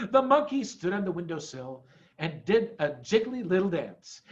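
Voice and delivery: ordinary delivery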